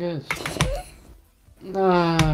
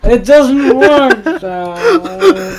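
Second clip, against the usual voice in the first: laughing; 7 semitones higher, 8 dB louder, much slower than usual. Real, not acted. Laughing fail in game.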